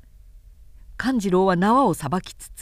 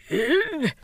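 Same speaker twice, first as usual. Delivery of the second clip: high, surprised exclamation; startled cry; 8 semitones higher than usual. Acted. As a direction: gasp out in dismay. Thief suddenly escapes.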